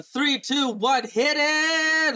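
loud, rising countdown shout; hype countdown. Acted. Podcast intro countdown.

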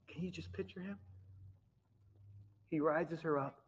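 ordinary delivery

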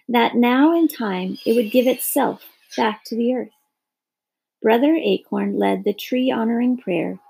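ordinary delivery

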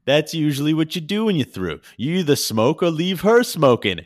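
loud, high-pitched playful teasing. Retold or imitated. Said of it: "he gets a little playful with him".

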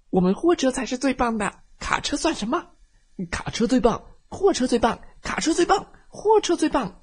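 put-on high, loud childish bickering, rising; angry squabbling. Trucks quarrel loudly. (高声争吵)

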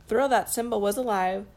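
ordinary delivery, nothing marked

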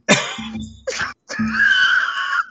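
big howling laugh. Real, not acted. Laughing after the quote.

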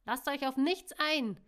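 high, loud, furious warning cry; angry shout. A narrator's reading. Lina warns the others against the attackers, shouting angrily. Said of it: "rief Lina wütend".

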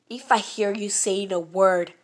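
loud, slow warning; slow loud threat. Retold or imitated. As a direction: lay down the threat slowly and loudly. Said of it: "the prince was like".

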